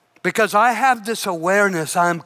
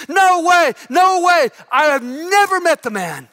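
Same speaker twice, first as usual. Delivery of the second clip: high, louder "No way, no way"; shouted denial; 7 semitones higher, 4 dB louder than usual. Acted. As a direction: yell it to shut them up. Peter shouting his denial.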